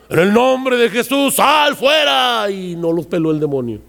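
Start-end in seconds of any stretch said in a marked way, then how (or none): 0.1-2.5 higher, shouted command, last words slow; mock exorcism shout. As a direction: command the demon out in a big shout. Discípulo exorcizando al demonio. (orden de exorcismo gritada y alargada)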